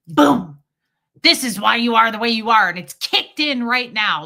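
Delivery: loud, high mock pronouncement; mock triumphant boom. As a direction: announce it like a gotcha. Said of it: "pull their trauma out and go".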